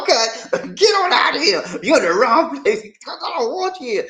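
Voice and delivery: high-pitched laughing retort; mock dismissal. Student retelling being told to leave, high mock dismissal. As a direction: send them off in a high, amused voice.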